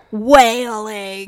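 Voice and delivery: loud, drawn-out mock wailing. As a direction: drag the word out into a wail. Mimes the wail on the word.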